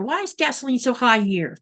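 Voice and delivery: ordinary talk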